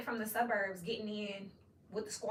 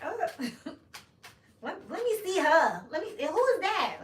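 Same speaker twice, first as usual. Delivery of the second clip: mock curious listener; 8 semitones higher, 8 dB louder, slower than usual. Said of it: "you're gonna be like".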